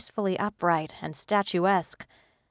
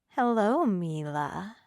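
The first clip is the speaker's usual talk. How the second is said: sinister mermaid; slower than usual. Acted. Sinister mermaid greets the girl.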